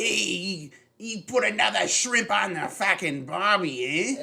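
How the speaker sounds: hammed-up Australian accent, high and loud; Kano. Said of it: "they make Kano really fucking Australian".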